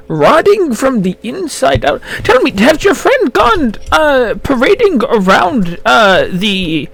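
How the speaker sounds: put-on high, helpful old gnome voice; old gnome shopkeeper.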